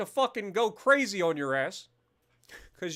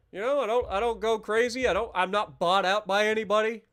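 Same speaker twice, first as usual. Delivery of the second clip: ordinary talk, nothing marked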